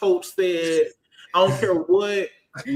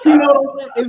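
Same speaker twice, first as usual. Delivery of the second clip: laughing; 7 semitones higher, 9 dB louder, faster than usual. Real, not acted. Laughing at own joke.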